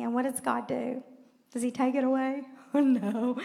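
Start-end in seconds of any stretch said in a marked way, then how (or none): none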